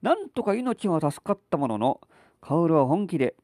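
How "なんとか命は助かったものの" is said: usual speaking voice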